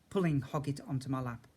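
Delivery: plain reading voice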